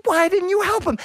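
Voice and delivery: high-pitched indignant farmer; exasperated scolding. Acted. Farmer scolds the crying crowd.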